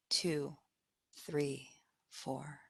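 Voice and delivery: very soft, low count fading away; exhale count. Acted. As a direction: let each number sink as they breathe out. Counting the out-breath.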